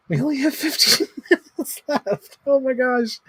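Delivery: high, laughing through the words. Real, not acted. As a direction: giggle at running out of time. Realizes little time left.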